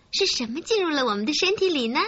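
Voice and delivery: ordinary delivery, nothing marked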